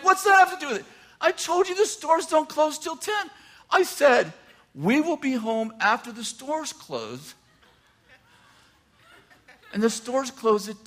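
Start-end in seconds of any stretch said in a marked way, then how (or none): 0.0-0.8 very high-pitched mock indignation; indignant retort. Husband arguing with wife about Christmas shopping, indignant retort. 1.2-7.3 high-pitched imitation of a wife explaining; exasperated wife. Wife telling husband about closing time, exasperated imitation.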